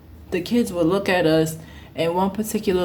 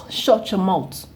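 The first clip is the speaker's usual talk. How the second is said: ordinary talk, nothing marked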